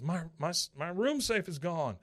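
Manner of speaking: high, stammering alarm; panicked. Retold or imitated. Doctor reporting to the front desk, panicked. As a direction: stumble over the first word in panic.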